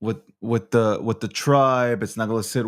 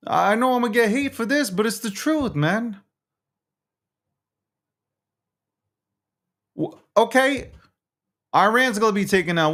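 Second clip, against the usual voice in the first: pitched-up, exasperated ranting; heated protest; 7 semitones higher than usual. Real, not acted. Host going against the tribe over war, heated and exasperated. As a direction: demand answers in a high, worked-up voice. Heated rant, demanding what comes next.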